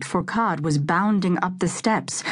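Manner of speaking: ordinary delivery, nothing marked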